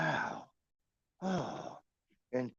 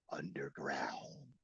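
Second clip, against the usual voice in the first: whisper; 10 semitones lower, 14 dB quieter, much slower than usual. Acted. Dramatic reveal of secret location.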